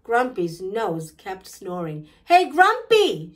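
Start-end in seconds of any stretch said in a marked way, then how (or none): 2.3-3.3 loud, high shout to wake someone; raised, high-pitched holler. A narrator's reading. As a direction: shout it to rouse a sleeper. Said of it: "shouted Charlie".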